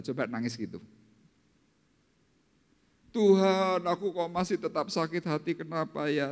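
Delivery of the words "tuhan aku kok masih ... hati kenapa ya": high imitation of a weeping plea; mock tearful prayer. Believer asking God why the heart still hurts, mock crying. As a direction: pretend to cry out to God. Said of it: "Lah coba nangis itu".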